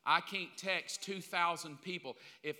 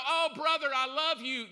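mock gushing greeting; 8 semitones higher, 9 dB louder than usual. Pastor mimics greeting a stranger.

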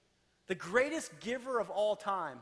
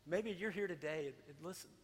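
much softer, intimate prayer over music; hushed altar call; 3 semitones lower, 10 dB quieter than usual. Acted. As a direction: gently guide them into prayer. Leads quiet altar-call prayer.

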